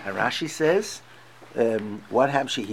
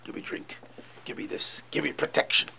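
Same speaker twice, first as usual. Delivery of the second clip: hushed pleading; 8 dB quieter than usual. Retold or imitated. Rabbi voicing needy demands.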